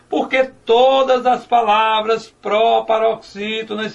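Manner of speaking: slow, every word weighted, stress on "todas"; teacher stating the rule slowly; slow emphatic rule. Shown as a demonstration. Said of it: "Lembram disso?".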